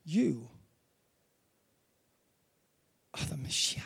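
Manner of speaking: hushed, breathless whisper; awed whisper. Retold or imitated. Preacher voicing Peter's awed confession to Jesus, whispered. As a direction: whisper it in awe.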